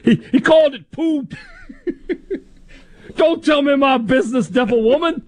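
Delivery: high-pitched movie quote with laughter; laughing, high comic impression; Billy Madison quote. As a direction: quote the comedy in a high, giggly voice. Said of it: "like, uh, Billy Madison".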